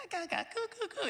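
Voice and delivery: put-on soft, high-pitched baby cooing; tiny, high gurgling coos; baby babble. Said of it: "and she'd be like".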